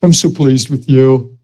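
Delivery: tender voice of God. Retold or imitated. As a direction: speak like a loving father to his boy. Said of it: "when you wake up saying".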